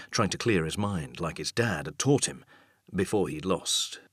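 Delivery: ordinary delivery, nothing marked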